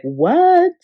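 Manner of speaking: high, loud shocked yelp; a stunned castmate gasping out a single disbelieving word; shocked what. Retold or imitated. Castmate reacting to the accusation with a high, loud shocked "What?". Jen shocked, mouth wide open.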